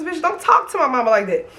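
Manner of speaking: normal talking voice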